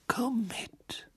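leise geflüstert, ruhig; ruhig ins Ohr. A narrator's reading. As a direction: sprich sanft direkt ins Ohr. Dumbledore holt Harry leise weg. (leise ruhig geflüstert)

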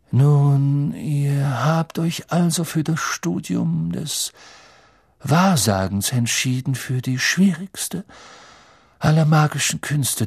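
mystical teacher, words drawn out; Professor Trelawney. Acted. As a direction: warn the class like a solemn seer. Trelawney warns her class. (gedehnt warnende Wahrsagerin)

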